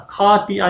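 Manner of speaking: "خَاطِئَةٍ" opening on aspirated k-h; kha misread. Shown as a demonstration. Teacher shows some women's mistake, "خَاطِئَةٍ" with aspirated k. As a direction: start "خَاطِئَةٍ" with kh as in khana, not the throaty fricative. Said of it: "خا کو کھا نہیں پڑھنا".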